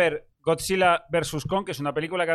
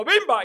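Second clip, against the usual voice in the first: mock punch sound; 9 semitones higher, 6 dB louder than usual. Imitating the monkey's punch.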